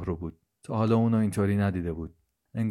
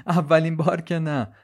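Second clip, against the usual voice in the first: higher and louder through a laugh; 8 semitones higher, 7 dB louder than usual. Acted. Sara laughing at Brian, brushing off his remark, higher and louder. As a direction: toss it off, still laughing. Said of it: "سارا بلند خندید و گفت".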